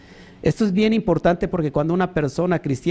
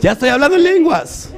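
real laugh, much higher and louder; laughing at himself; 10 semitones higher, 6 dB louder than usual. Real, not acted. Preacher stumbles over a word and laughs at himself loudly. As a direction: crack up at your own slip. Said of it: "perdón, ya se me trabó la lengua".

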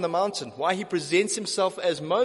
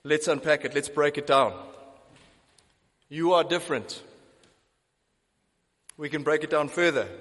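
ordinary talk

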